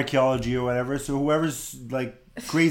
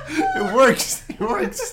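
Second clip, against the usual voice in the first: laughing; much slower than usual. Real, not acted. Laughing approval of own bit.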